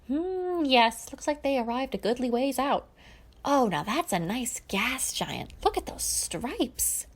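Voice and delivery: a hint of a jolly old uncle admiring planets; lightly voiced Coran; Coran's voice. A narrator's reading. Coran voiced in fan fiction read aloud.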